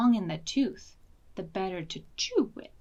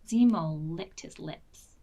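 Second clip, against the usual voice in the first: ordinary delivery, nothing marked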